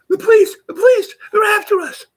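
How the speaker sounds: imitating a panicked, high-pitched shriek; panicked scream. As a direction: shriek it loud and very high, hammed-up terror. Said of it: "I hear this guy scream".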